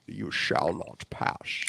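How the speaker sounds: low hushed Gandalf. Retold or imitated. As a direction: murmur the line instead of yelling. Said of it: "instead of yelling".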